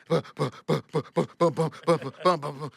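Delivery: rhythmic "bum" noises of a jack; imitated pumping. Storyteller mimicking pumping the jack under the bus, steady rhythmic noises. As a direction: puff out a steady run of pumping thuds. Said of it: "and I start pumping".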